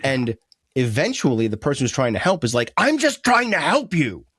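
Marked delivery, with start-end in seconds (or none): 2.8-4.3 high, stretched-out exasperated protest; acted frustration, dragging the words out; mad. Helper to upset friend after being rebuffed, mad and high-pitched. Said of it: "So we get mad at them".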